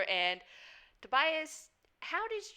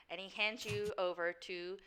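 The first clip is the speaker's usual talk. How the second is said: ordinary delivery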